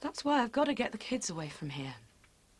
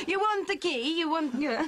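put-on Italian accent; 6 semitones higher, 4 dB louder than usual. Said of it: "it's supposed to be Italian".

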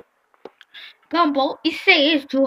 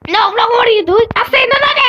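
repeated protest; 5 semitones higher, 6 dB louder, faster than usual. Real, not acted. Protesting the game.

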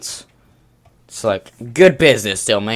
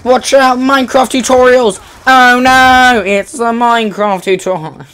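high, loud panicky callouts; excited panic; 10 semitones higher, 9 dB louder than usual. Real, not acted. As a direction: sound the alarm in a rush.

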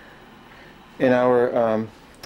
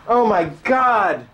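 slow, higher disgusted groan; drawn-out disgust; 9 semitones higher, 5 dB louder, much slower than usual. Real, not acted. Disgusted on finding bird droppings everywhere. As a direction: stretch it out in dismay.

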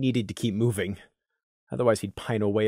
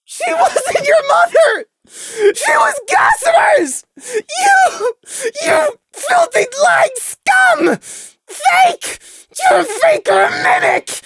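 slow, far higher and louder, crying shout; screaming accusation; 9 dB louder, much slower than usual. Acted. Prey screaming at Lemon that she's a fake. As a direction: scream it through sobs.

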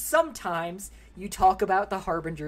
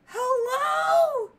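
much higher, loud disbelieving calls; shocked shout; 6 dB louder, much slower than usual. Real, not acted. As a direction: yell in stunned disbelief. Stunned by Cortana's remark.

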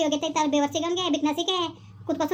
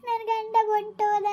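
wailing lament; 5 semitones higher, much slower than usual. Acted. Wife laments runaway husband.

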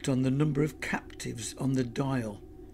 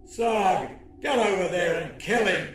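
raised, urgent alien order; imperious higher-pitched villain command; 5 semitones higher than usual. A narrator's reading. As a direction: order a henchman to attack as an alien boss. Alien boss orders a henchman.

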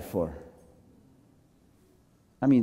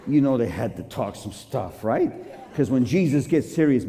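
ordinary delivery

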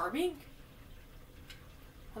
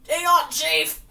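urgent shout; 6 semitones higher, 11 dB louder, slower than usual. Real, not acted. Fleeing a chasing Pokemon.

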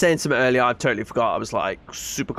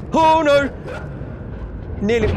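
panicked yelp; 12 semitones higher than usual. Real, not acted. Chased by demon dogs.

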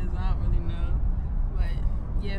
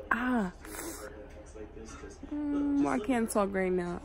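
pulling lip aside while talking; speaking with sore teeth; fingers at mouth; 4 dB quieter, slower than usual. Real, not acted. Seen 0: Fingers at lips and mouth corner, pulling cheek over braces.

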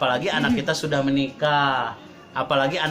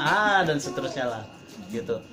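usual speaking voice

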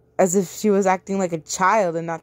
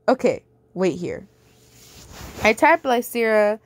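ordinary delivery

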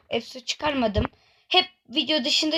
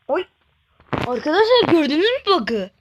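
louder, higher excited call to the viewers; 4 semitones higher, 6 dB louder than usual. Real, not acted. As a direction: grab the viewers' attention, amazed. Spots a bug, calls to viewers. (heyecanla seslenme)

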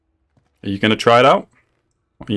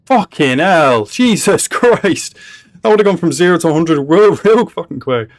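high, raised voice of real shock; stunned outburst; 7 semitones higher, 4 dB louder than usual. Real, not acted. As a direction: exclaim in astonishment at the sudden turn. Stunned by sudden turn in show.

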